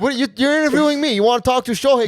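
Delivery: hammed-up jealous teammate fuming into the mic; mock indignant outburst. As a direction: snap back at the interviewer, faking outrage. Jealous player mocking interviewer, acted.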